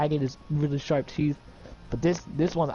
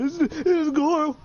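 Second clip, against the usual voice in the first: much higher mock exclamation; mocking high squeal; 13 semitones higher, 3 dB louder than usual. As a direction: squeal it like an eager fan. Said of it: "I'm making sure you're like".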